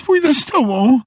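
very high squeal of a call; squeaky. A narrator's reading. Meg offers to go with mother, squeaky cry. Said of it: "zawołała Meg piskliwym głosem".

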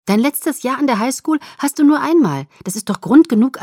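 high, loud, lively persuasion; emphatic, eager insistence; enthusiastic urging. A narrator's reading. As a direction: talk a reluctant friend round. Eager friend urging her reluctant friend.

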